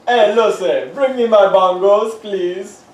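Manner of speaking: put-on loud, booming Cuban-accented husband voice; Ricky impression. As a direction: belt it out like Ricky calling for Lucy. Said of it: "I'd be Ricky".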